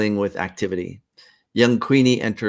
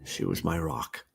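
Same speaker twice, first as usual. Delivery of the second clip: much softer, lower; quiet grief; 5 semitones lower, 10 dB quieter than usual. Acted. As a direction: say it almost to yourself. Grieving son.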